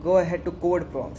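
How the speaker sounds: usual speaking voice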